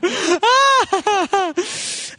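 high burst of laughter; real high laugh. Real, not acted. Laughter after the nos.

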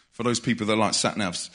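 usual speaking voice

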